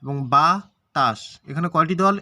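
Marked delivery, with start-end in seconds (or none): 0.3-1.2 বাতাস slowly split into two beats; syllable by syllable. Shown as a demonstration. Teacher showing there are two syllables, syllable by syllable. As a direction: pause inside বাতাস between its two syllables. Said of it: "এখানে দুইটি দল".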